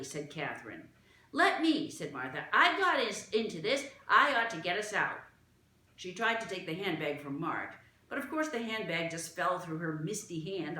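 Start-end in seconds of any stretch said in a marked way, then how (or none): 1.3-5.2 put-on louder, higher resolute girl; determined Martha. Martha insists she will fix it, determined. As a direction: step up firmly like a guilty child.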